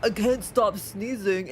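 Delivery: slow, drawn-out mock complaint; hammed-up fake-innocent whine. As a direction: poke fun at a showy sneezer. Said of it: "the person sneezes like".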